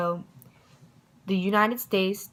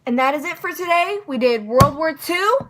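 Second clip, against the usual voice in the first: loud, high and bright wrap-up; excited; 11 semitones higher, 9 dB louder than usual. Real, not acted. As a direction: celebrate finishing the lesson.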